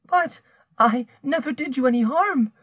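high-pitched, despairing protest. A narrator's reading. Rose protests to the man threatening her, despairing. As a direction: plead your innocence in a high voice. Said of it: "Rose cried in despair".

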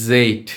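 "জেড" with a long voiced Z buzz; tongue forward, sustain the Z in "জেড"; slow, stretched "জেড", buzzing onset; buzzed letter Z. Shown as a demonstration. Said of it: "সামনের দিকে".

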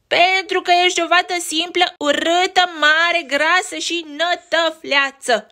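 high, loud jeering bully; nasty rabbit insulting the sister; bully yelling. Acted. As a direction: hurl it at Betty. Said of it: "zbieră iepuroiul bătăuș".

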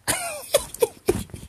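long high shriek of laughter; high squealing laugh. Real, not acted. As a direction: let out a high squealing cackle. Laughing at his own joke. (long éclat de rire aigu)

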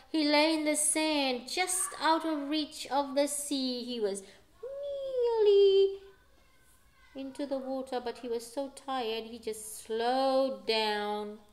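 usual speaking voice

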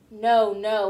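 a mild, anxious outcry of denial; crying out. Acted. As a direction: protest your innocence urgently. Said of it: "Woody cried".

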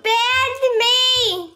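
high, slow, drawn-out dismay; slow high lament, stretched out; anguished wail. Real, not acted. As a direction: wail it like the worst news ever. Just got banned in game.

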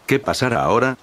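plain reading voice

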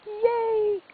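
high squeal of delight; excited. Real, not acted. Cheering about owning the toy.

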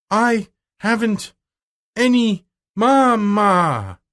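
put-on high, very slow childish wail; wailed announcement. A narrator's reading. Crying child announcing to the watching girl, drawn-out wail. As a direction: stretch each word into a loud, protesting howl. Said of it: "she announced".

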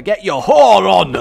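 loud, high Scottish shout; mock-Scottish outburst; Scottish accent. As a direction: belt it out in broad Scottish. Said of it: "why I said it so Scottish".

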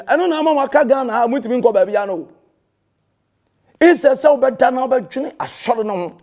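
ordinary talk, nothing marked

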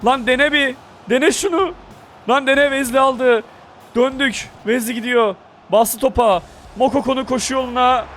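high, rising calls for the ball, then a shout; excited shouting. Real, not acted. Gamer begging his teammate to pass and shoot, excited shouting.

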